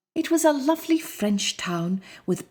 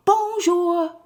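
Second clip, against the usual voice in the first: cheerful greeting; 10 semitones higher, 4 dB louder than usual. Acted. Townsfolk greet the lion.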